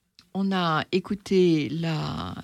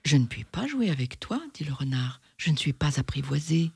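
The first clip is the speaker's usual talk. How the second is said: story read aloud. A narrator's reading. Reading Le Petit Prince aloud to the audience, in a storytelling voice. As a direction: read the tale to the audience, calmly. Reads story narration and fox.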